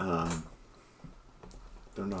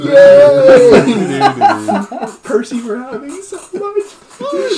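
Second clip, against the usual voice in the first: laughing; 18 dB louder, much slower than usual. Acted. Party swinging a body around.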